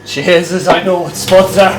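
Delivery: put-on Irish accent. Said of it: "you do the Irish accent".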